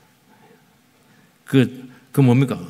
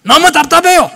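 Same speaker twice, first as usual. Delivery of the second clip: 답답해요 cried out high and loud, falling at the end; stifled cry; 10 dB louder than usual. Acted. Pastor venting his stifled gift, 답답해요 cried out loud. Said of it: "내 은사를 그냥 제한해야 돼요".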